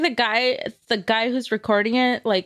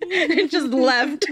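slow, laughing punchline; 3 semitones higher, slower than usual. Real, not acted. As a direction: drag it out while laughing.

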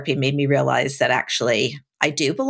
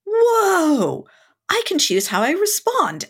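high, wondering voice full of discovery; amazed realization; 10 semitones higher than usual. Retold or imitated. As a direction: voice lifting in awe as it dawns. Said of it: "I was like".

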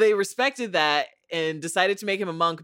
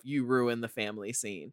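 muttered quote; 7 semitones lower, 8 dB quieter than usual. Retold or imitated. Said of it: "think to myself".

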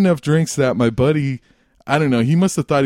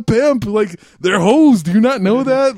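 exasperated retelling, louder on the punchline; acted exasperation; 8 semitones higher, 4 dB louder than usual. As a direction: spell it out to a clueless friend, pitched up. Acted argument with clueless buddy.

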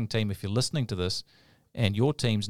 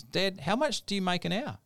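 lightly voiced child, pitched higher; young son; 10 semitones higher than usual. Young son asking dad a question, lightly voiced higher. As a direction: put on a hint of a curious boy. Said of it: "the son says".

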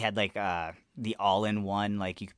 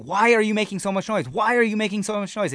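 annoyed yell; 10 semitones higher, 5 dB louder than usual. Retold or imitated. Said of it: "He's like".

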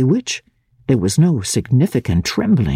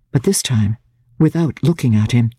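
usual speaking voice

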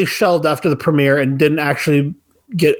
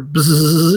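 buzz sound. Retold or imitated. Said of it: "wave it over this cup of water".

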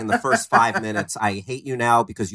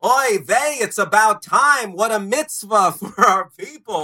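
put-on Yiddish accent; 9 semitones higher, 4 dB louder than usual.

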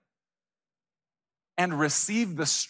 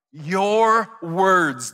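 "your words" drawn out and punched louder; "your words" as two big stressed beats; emphasis on YOUR WORDS; 6 dB louder than usual. Shown as a demonstration. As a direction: lean hard on "your words". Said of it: "the emphasis on these words".

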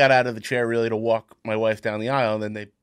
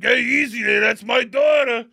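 mock protective shout; 10 semitones higher, 4 dB louder than usual. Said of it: "He's like".

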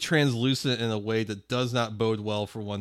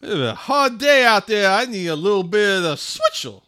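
high loud put-upon gentleman; weary rich man; 9 semitones higher, 8 dB louder than usual. Retold or imitated. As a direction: sigh it like a pampered gent. Voicing a weary rich guy.

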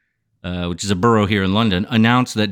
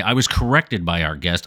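normal talking voice

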